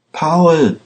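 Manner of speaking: urgent shout. A narrator's reading. Pandora calls Paul as bombers approach, urgent shout. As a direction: cry out to him in alarm. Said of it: "schrie sie".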